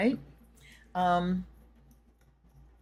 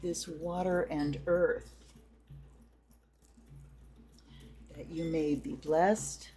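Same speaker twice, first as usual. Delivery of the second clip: ritual invocation. Acted. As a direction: intone it like a ceremony. Leads a smoke, water and bell ritual.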